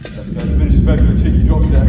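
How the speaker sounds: loud hyped-up gym shout; hype shout. Real, not acted. Lifter pumping himself up before the drop set, shouting. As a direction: fire yourself up for the last set, loud.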